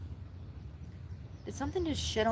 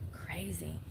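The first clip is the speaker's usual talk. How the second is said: whispered; 3 semitones lower, 10 dB quieter than usual. Real, not acted. Aside after shocking statistic.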